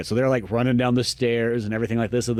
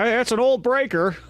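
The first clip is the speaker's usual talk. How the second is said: high, put-on old handyman voice; hotel manager; 9 semitones higher than usual. Hotel manager explains the power outage, put-on old man voice. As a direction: imitate an old fellow, pitched up. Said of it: "you run into the hotel manager".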